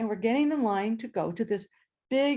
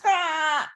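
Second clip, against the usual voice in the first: startled chicken cry; 6 semitones higher, 3 dB louder than usual. Retold or imitated. Chicken squawks when petted.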